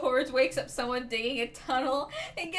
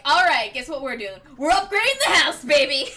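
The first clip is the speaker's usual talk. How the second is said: loud, rallying handyman hype; excited toolkit-wielding buddy; gung-ho Mitz; 7 dB louder than usual. Acted. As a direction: announce the job with gusto, building louder. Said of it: "Mitz would bust out the toolkit like".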